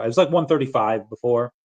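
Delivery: ordinary talk, nothing marked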